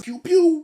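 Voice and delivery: high, loud "pew pew"; mock-shooting sound effect, pitched high; playful gunshot noises. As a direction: fire off cartoon laser shots with your mouth. Imitates gunshots after shooting line.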